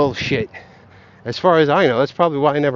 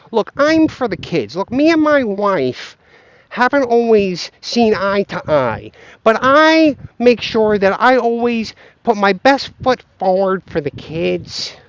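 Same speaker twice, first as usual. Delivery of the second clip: slow, higher-pitched earnest impression; Greg Foss; 4 semitones higher, slower than usual. Man insisting he's for the kids, slow impression. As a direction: imitate him, drawing out each phrase. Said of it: "a pretty good Greg Foss impression".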